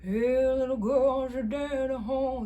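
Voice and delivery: loud underbite rock-star growl-free call. Retold or imitated. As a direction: push the jaw forward and belt it like a rocker. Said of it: "You think about [name]".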